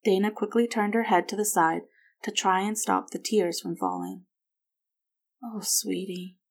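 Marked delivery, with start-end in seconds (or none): 5.4-6.4 softly voiced motherly comfort; soft, tender. A narrator's reading. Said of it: "Margaret said softly".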